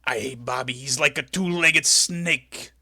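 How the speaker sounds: lightly voiced Macho Man, slowing on the last line; a hint of Macho Man sneering at Heenan; Macho Man impression. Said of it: "I look at my wife".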